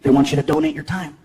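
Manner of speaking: low flat agent voice. Retold or imitated.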